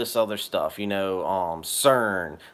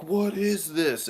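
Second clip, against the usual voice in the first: amazed; 8 semitones higher, slower than usual. Retold or imitated. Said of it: "I'm just like".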